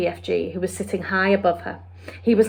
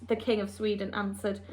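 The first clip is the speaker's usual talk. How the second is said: ordinary delivery, nothing marked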